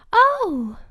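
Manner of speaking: high, loud wondering oh; a breath of delight. Acted.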